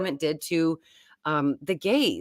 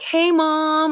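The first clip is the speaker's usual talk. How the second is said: imitating the kids; 10 semitones higher, 5 dB louder than usual. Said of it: "They were like".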